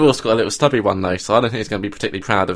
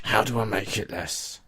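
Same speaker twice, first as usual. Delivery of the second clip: toned-down character voice; 10 dB quieter than usual. Shown as a demonstration. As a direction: hold the character but much quieter. Toning down the put-on voice.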